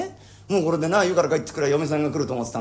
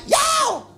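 a sudden shrill whoop, far higher; wild whoop; 3 dB louder, much slower than usual. Acted. Carried away by his fantasy, a shrill whoop. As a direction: let out a crazed cry of glee.

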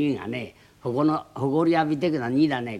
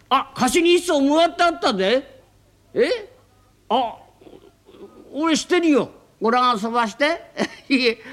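high, loud eager offer; landlady suddenly remembering a boat; excited landlady; 10 semitones higher, 4 dB louder than usual. Acted. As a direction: jump in eagerly.

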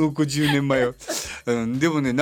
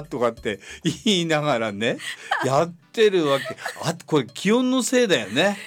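ordinary delivery

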